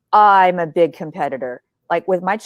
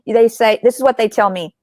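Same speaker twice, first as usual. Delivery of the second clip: ordinary talk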